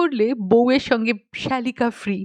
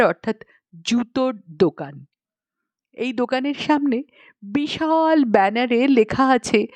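plain reading voice

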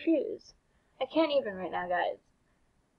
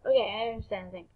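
usual speaking voice

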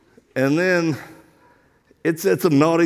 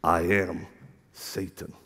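very low, hushed demon; 12 semitones lower, 9 dB quieter, slower than usual. Retold or imitated. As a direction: drop deep and soft, menacing as Satan. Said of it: "this demon goes".

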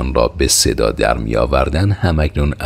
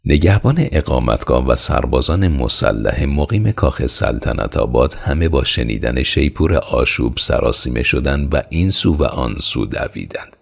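usual speaking voice

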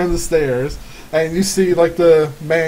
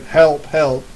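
mild mock cry for help at near-normal pitch; half-hearted shout for help; 4 dB louder than usual. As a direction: call for help without really yelling. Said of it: "you hear somebody shouting out".

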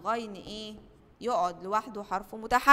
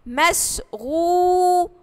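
drawn-out, higher, louder word; stretched out slowly; 6 semitones higher, 10 dB louder, much slower than usual. Shown as a demonstration. Teacher models مشغول for first graders, drawn out slowly. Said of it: "عندي أول كلمة كلمة إيه؟".

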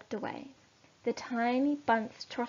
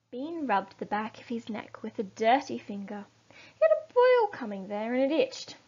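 plain reading voice

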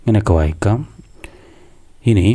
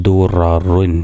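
slow blending; much slower than usual. Shown as a demonstration. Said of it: "അപ്പോൾ അങ്ങനെ കൂട്ടി വായിക്കാം".

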